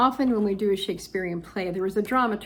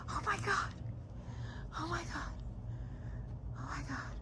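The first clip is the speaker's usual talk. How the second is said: awed whisper; 6 semitones higher, 15 dB quieter, faster than usual. Real, not acted. Awed in hushed space.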